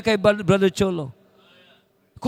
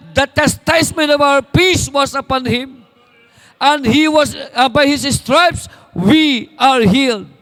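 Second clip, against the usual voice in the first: loud, higher, slow shouted scripture; proclaimed verse; 5 semitones higher, 9 dB louder, slower than usual. Acted. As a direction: cry the verse out to the church. Shouted verse over music. (sumisigaw na pagpapahayag ng talata)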